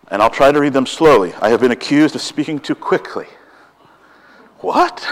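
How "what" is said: a sudden high, playful "What?"; mock surprise. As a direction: jump way up in pretend shock. Mock reaction to being accused.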